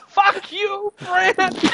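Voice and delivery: very high imitated angry cry; losing it. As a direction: mimic an enraged, high-pitched outburst. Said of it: "He absolutely loses it".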